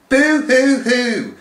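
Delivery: slow, high fake sobbing; teacher mimicking a crybaby; mock boo-hoo. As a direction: sob sarcastically. Mocking fake crying.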